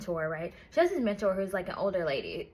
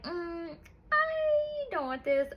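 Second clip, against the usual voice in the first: high, whimpering complaint, pitched way up; whiny protest; 7 semitones higher, much slower than usual. Acted. Heroine refusing her arranged marriage, high whiny whimper. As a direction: whine it like a spoiled girl refusing.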